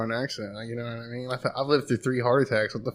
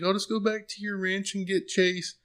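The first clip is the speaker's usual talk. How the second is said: higher-pitched put-on voice; imitating female companion; 8 semitones higher than usual. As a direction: mimic her in a higher voice. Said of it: "And she's like".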